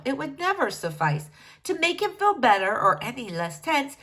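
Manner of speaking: ordinary delivery, nothing marked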